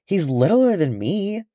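high, put-upon protest; defensive. A narrator's reading. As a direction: pout it out defensively. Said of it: "Kili said defensively".

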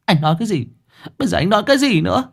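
high, rushed exasperated woman; angry woman. Acted. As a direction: fire the questions at him. Woman repeats angry question.

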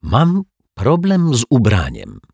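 hesitant, embarrassed statement with falling end; embarrassed admission. A narrator's reading. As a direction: own up awkwardly, drawing the words out. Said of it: "mruknął zaczerwieniony pan Mietek".

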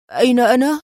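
a touch of shock in the question; surprised exclaim. A narrator's reading. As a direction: wake up astonished, voice lifting. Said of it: "وهتف في دهشة".

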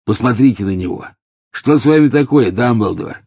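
mocking witch. A narrator's reading. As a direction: jeer at the old man. Alecto mocks dying Dumbledore. (насмешливо, выше и громче)

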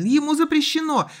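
high, loud insistent cry; loud desperate protest; frantic shout. A narrator's reading. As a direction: shout it like a distressed boy. Frantic boy in a shouted quarrel.

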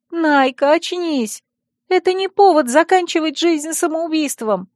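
high-pitched, urgent appeal; earnest persuasion. A narrator's reading. Friend pins the heroine down, persuading her earnestly. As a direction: talk her out of it insistently. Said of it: "убедительно проговорила".

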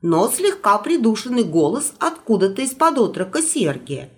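plain reading voice

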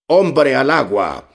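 captain shouting man overboard; alarm shout. A narrator's reading. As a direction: cry the alarm across the ship. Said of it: "había gritado el capitán".

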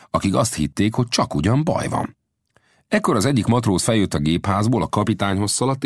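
ordinary delivery, nothing marked

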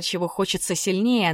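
plain reading voice

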